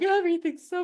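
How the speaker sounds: high, gushing overwhelmed voice; gushy high quote. Retold or imitated. Recalling crying at everything, high gushing voice. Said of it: "I'm like".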